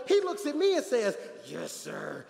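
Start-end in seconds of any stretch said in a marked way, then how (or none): 1.5-2.2 put-on low, soft, subdued teen reply; respectful son. Mike answering his fussing father, respectful and subdued. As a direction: answer dad low and obediently. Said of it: "he's so respectful".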